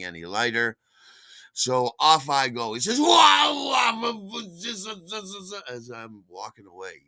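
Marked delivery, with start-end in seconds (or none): 3.0-5.5 high loud wail fading into slobbered babble; mock drunk holler that falls apart; imitated drunken howling. Drunk calling after the departing delivery man, high howl dwindling to babble.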